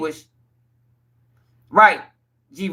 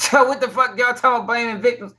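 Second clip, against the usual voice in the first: ordinary talk, nothing marked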